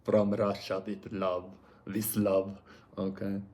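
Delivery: slow, lightly put-on Russian accent; a playful, lightly put-on Russian accent. Reader joking between piles, slow hint of Russian accent. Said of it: "Forgot my Russian accent there".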